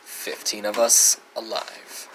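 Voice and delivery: low, hushed ominous close; dramatic narration. A narrator's reading.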